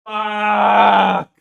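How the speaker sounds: loud, high, drawn-out curse; long wail of a swear word; anguished shout. Real, not acted. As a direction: yell it out long, hurt by the scene. Upset outburst at sad scene.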